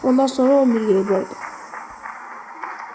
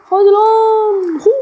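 drawn-out plea; 8 semitones higher, 7 dB louder, much slower than usual. Real, not acted. Frustrated urging in game.

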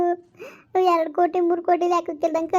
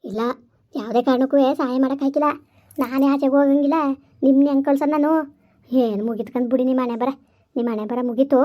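low, eerie ghost warning of the trafficker; 6 semitones lower, faster than usual. Acted. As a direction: speak low like a knowing ghost. Ghost refuses. (ತಗ್ಗಿದ ದೆವ್ವದ ಧ್ವನಿ)

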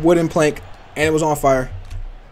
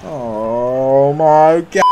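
slow, stretched-out "Oh my God"; drawn-out dismay; 3 semitones lower, much slower than usual. Real, not acted. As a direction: drag every word out in disbelief. Stunned by the game.

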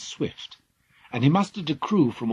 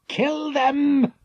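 much higher, louder furious command; raging order; 7 dB louder than usual. Acted. King ordering his dragon-tooth army, raging. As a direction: unleash the army. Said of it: "the king raged".

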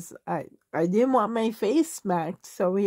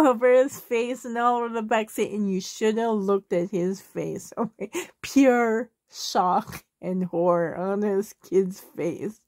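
usual speaking voice

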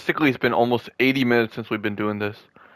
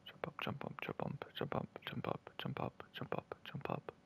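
breathy whispered chant; whispering; 26 dB quieter than usual. Real, not acted. Urging his teammate to jump, whispered repeatedly. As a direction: whisper it over and over to coax him.

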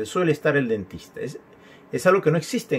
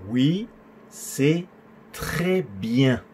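drawn out, with pauses between words; slow word-by-word model; much slower than usual. Shown as a demonstration. Teacher repeating an answer for students, slow word-by-word. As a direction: stretch each word for learners to copy.

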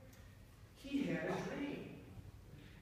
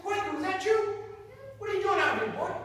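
high, loud call of a surprised father; a father spotting his son in the field; loud, high startled dad; 11 semitones higher, 7 dB louder, faster than usual. Acted. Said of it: "He said".

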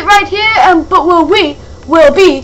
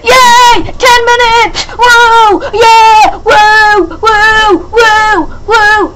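excited cheering; 3 semitones higher, much slower than usual. Real, not acted. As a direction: cheer it out, celebrating. Celebrating ten minutes.

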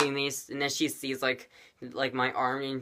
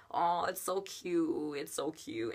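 soft, drawn-out adoring coo; 4 semitones higher, 7 dB quieter, slower than usual. Retold or imitated. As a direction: coo over something adorable. Acts cooing over cute band-aid.

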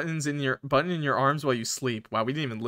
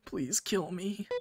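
high, hushed, broken plea; a weak, pained whisper of a request; dying plea; 4 semitones higher, 7 dB quieter, much slower than usual. Acted. Button in agony begging her friend, high and faint.

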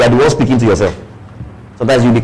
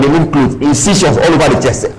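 ordinary talk

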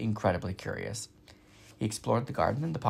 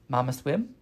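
little gosling. Acted. Gosling asks his mother.

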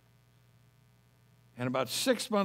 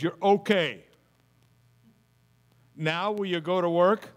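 a louder, commanding voice from above; God's loud firm command to get to work; 3 semitones higher, 7 dB louder than usual. Retold or imitated. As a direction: speak as God giving an order, booming. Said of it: "as it was an audible voice".

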